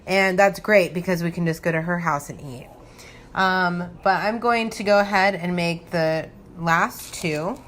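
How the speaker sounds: normal talking voice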